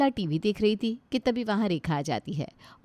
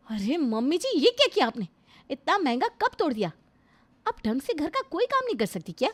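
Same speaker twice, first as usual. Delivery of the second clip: higher, sharp scolding voice; scolding daughter-in-law; 7 semitones higher than usual. Acted. Daughter-in-law scolding mother-in-law over a broken cup, raised and sharp. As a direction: tell her off for breaking the cup.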